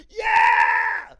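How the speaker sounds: long, high goal scream; drawn-out victory shout; celebrating yell. Retold or imitated. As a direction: shriek as the goal goes in. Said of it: "when they make the goal".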